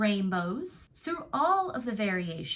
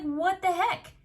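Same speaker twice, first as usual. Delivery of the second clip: rising frustration, higher and louder to a shout on prove it; exasperated outburst; 5 semitones higher, 3 dB louder than usual. Retold or imitated. Fed-up believer demanding proof from the universe, exasperated rising shout. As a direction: challenge the universe, building to a demand. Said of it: "it was like".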